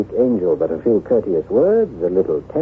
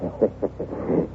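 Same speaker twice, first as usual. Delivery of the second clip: soft hushed chuckle; quiet amused laugh; 3 semitones lower, 8 dB quieter than usual. Acted. When Maurice guesses a wife, a soft amused chuckle. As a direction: chuckle softly at the wrong guess.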